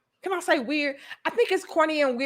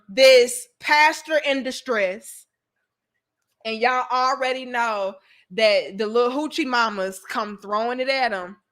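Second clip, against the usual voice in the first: normal talking voice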